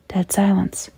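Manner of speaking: ordinary delivery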